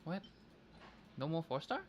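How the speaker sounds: ordinary talk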